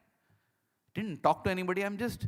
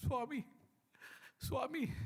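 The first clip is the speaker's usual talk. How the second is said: high, soft, sobbing calls, re-enacted; retold crying; 9 semitones higher, 8 dB quieter than usual. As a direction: squeeze the name out through mock tears. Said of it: "I'm just crying".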